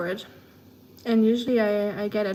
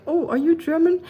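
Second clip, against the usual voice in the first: higher imitation of a surprised newcomer; mock surprise; 7 semitones higher, 4 dB louder than usual. New person shows up in the stream, mock surprised. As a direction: pitch the question up, playfully imitating surprise.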